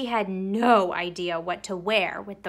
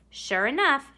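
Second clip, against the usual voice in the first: ordinary delivery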